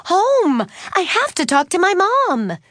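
high, loud, in a rush to go; urgent exclamation. A narrator's reading. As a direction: call it out while racing off. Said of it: "Suzanne exclaimed".

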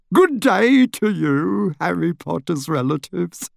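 high, slow, fawning bow voice; a little wizard bowing deeply; fussy little wizard. A narrator's reading. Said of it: "said Daedalus happily".

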